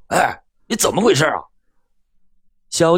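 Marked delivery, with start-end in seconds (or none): 0.1-1.3 mildly angry driver, raised pitch; angry question. A narrator's reading. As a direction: demand an explanation with some anger. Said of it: "声中带怒地问道".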